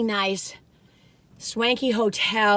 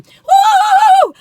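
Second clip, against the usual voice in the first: loud, high "woo"; excited whoop; 15 dB louder than usual. Real, not acted. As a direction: let out a big goofy whoop. Goofball whoop while moving on.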